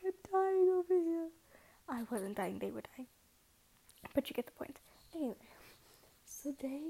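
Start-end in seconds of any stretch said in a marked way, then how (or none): 0.0-1.3 much louder and higher mock anguished cry; hammed-up overwrought lament; dramatic wail. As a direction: wail it like you're dying of heartbreak. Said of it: "I'm like".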